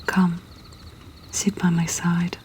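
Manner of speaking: plain reading voice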